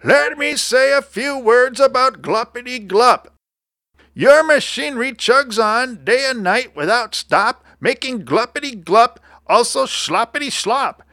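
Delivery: high-pitched creature tirade; indignant little creature working up to an accusation; dander up. A narrator's reading. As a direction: scold the culprit in a high, riled cartoon voice. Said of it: "his dander was up".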